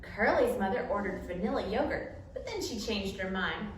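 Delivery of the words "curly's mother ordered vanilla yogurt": usual speaking voice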